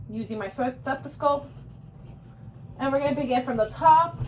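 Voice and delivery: normal talking voice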